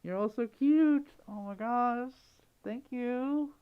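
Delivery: high, squeaky overwhelmed thanks; adoring squeal of gratitude; touched gushing. Real, not acted. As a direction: gush in a high, melting voice. Overwhelmed by viewers' generosity.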